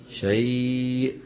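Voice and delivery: شيء recited very slowly, ending on a silent hamza; slow pause form. Shown as a demonstration. Teacher showing students how to stop on the word, very slowly. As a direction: stop on شيء with no vowel, stretched. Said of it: "كيف يقفون عليها؟".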